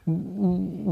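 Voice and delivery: normal talking voice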